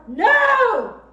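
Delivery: loud, high shout from the belly; big loud no. Shown as a demonstration. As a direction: yell it from the belly to stop an attacker. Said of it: "let me hear a big, loud".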